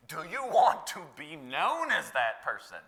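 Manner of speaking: ordinary delivery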